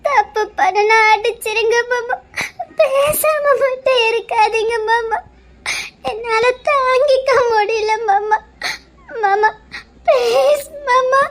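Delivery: slow, crying plea; weeping, slow begging; tearful pleading. Acted. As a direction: beg him through tears.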